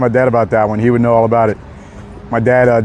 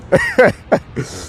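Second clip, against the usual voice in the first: giggling laugh, higher; 9 semitones higher than usual. Real, not acted. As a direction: break into laughter. Laughing at the viral moment.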